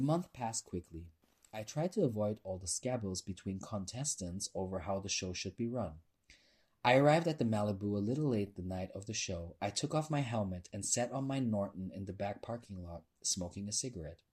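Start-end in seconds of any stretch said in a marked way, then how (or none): none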